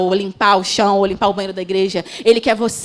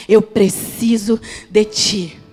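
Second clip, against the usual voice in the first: drawn out very slowly, each word weighed; slow pleading prayer; 3 dB louder, much slower than usual. Acted. Preacher repeating the prayer to God, very slow and pleading. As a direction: plead it slowly, like a whispered vow.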